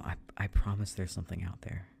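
ordinary talk, nothing marked

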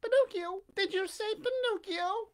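very high puppet voice, questioning; startled Pinocchio. A narrator's reading. Puppet hearing own name from the pigeon, high startled question. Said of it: "repeated the puppet".

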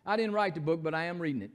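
ordinary delivery

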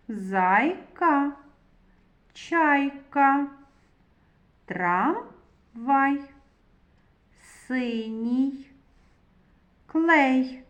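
each word read out loud and separate, with a pause after each; words read aloud. Shown as a demonstration. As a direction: read every word clearly for children. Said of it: "Прочитаємо слова".

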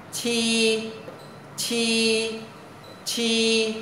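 "chi" with a puff of air, held high and level; aspirated sound. Shown as a demonstration. Teacher introduces the hard-to-read letter, aspirated sound. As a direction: push air through the teeth on "chi". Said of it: "Phải có hơi bật ra".

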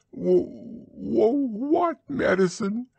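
high frightened stammer; stammering Piglet. A narrator's reading. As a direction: stutter out in dread.

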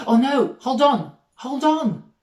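high, loud playful panic; sudden alarm. Acted. Teacher remembering something at sign-off, mock-alarmed interruption. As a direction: cry out as if stopping everyone.

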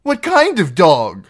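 high, loud, slow question; bewildered. A narrator's reading. Captive questioning the outlaw, bewildered. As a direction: puzzle over it anxiously. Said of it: "Merritt was lost".

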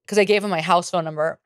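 ordinary talk, nothing marked

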